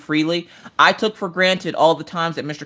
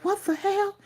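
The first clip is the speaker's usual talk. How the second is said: stunned; 8 dB quieter than usual. Retold or imitated. Mom reacting to thrown toast.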